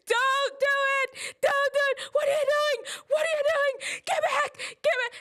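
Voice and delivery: high-pitched, frantic screaming; panicked scream. Retold or imitated. Sister begging brother back from the cliff, panicked screaming. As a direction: shriek it in a hammed-up panic. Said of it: "And I'm just screaming".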